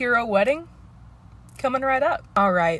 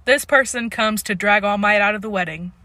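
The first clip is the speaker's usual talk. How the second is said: normal talking voice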